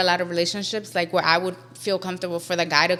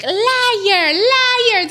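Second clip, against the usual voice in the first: high, loud mock shriek of accusation; imitated wild outburst; 14 semitones higher, 6 dB louder than usual. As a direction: yell it like an aggressive mom accusing. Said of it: "starts wilding".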